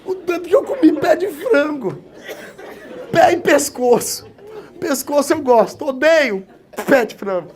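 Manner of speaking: preacher laughing at his own chicken-foot story. Real, not acted. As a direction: tell it while cracking up. Joking about chicken feet.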